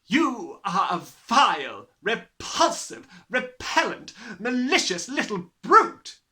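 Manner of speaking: loud, slow, spat insults; shouting. A narrator's reading. Headmistress shouting insults at Matilda, loud and slow. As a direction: spit out every insult. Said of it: "was shouting".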